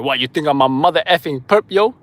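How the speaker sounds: retold indignant outburst. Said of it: "He's like".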